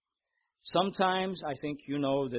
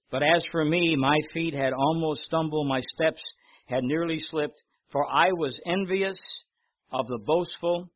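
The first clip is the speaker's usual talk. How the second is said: loud, declaiming psalm reading; preacher reading the psalm aloud; scripture reading; 6 dB louder than usual. Acted. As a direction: proclaim the verses to the congregation.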